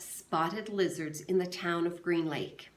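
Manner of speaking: ordinary delivery, nothing marked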